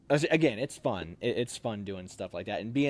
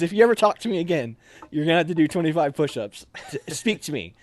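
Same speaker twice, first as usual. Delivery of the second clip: louder, higher mock warning through a laugh; laughing threat; 5 semitones higher, 6 dB louder than usual. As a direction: warn him while half laughing. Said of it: "And he just goes".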